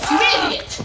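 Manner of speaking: loud, high-pitched outburst; exasperated yell. Real, not acted. Player scolding someone in the game, exasperated yell.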